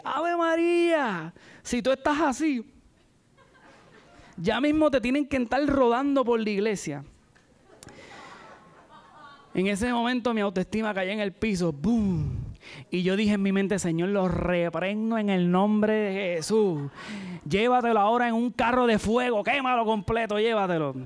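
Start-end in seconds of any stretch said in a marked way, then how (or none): 0.0-7.0 a high, laughing mock of the teasing brother; mocking church brother. 13.8-21.0 mock rebuking prayer. Mock prayer in his head.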